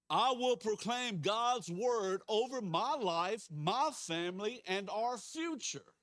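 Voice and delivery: slow, loud leading of a congregation; declaration of faith. Real, not acted. Pastor leading a repeated confession of faith, bold declaration. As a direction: declare it boldly, word by word.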